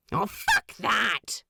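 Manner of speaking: slow, biting dismissal; sharp retort. A narrator's reading. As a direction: spit it out, fed up with the rule. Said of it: "says Crawley sharply".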